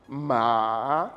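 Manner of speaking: "ma" starting low and sweeping up; rising second tone. Shown as a demonstration. Teacher showing M with A, "ma" in rising second tone. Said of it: "Le deuxième ton".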